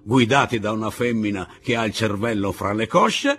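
jeering voice, building in pitch; scornful Pablo. Acted. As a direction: taunt climbing very high.

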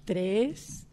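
ordinary delivery

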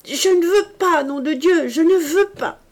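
a full-throated furious yell; furious shout. A narrator's reading. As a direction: bellow it out like a madman. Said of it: "accès de fureur en criant".

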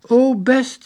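high, loud and chirpy; keen girl's exclamation; eager agreement. A narrator's reading. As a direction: agree brightly, pitch leaping up. Monica readily agrees once more.

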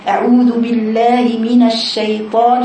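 slow recitation holding the ش of "الشيطان"; drawn-out recitation. Shown as a demonstration. Teacher showing pupils a long sheen, slow measured recitation. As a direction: stretch "الشيطان", lingering on its ش. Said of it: "شوف الشين طويلة".

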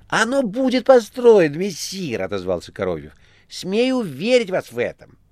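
very high, loud theatrical tale; Korovyev, mock terror. A narrator's reading. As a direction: ham up the panic. (Коровьев с наигранным ужасом)

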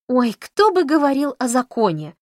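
high, loud, bristling retort. A narrator's reading. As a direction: bristle and fire it back loud. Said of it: "ощетинилась Камила".

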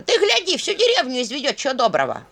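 high, laughing outburst; laughing scold. A narrator's reading.

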